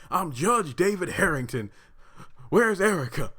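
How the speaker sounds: high-pitched mock cry of an injured man; imitated screaming. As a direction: imitate a frantic patient calling out. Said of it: "he kept screaming for Erica".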